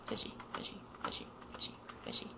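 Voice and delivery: whisper. Pretend. Teasing friend as fish.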